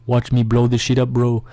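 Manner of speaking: lightly voiced drawn-out boast; slow mock villain boast; Shaquille O'Neal. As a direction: taunt like a cocky game boss. Acting Shaq's taunt in the fight.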